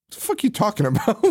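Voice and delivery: high mocking guard voice; a lightly voiced guard; guard's retort. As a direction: toss it back like a baffled guard. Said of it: "the fucking guard's like".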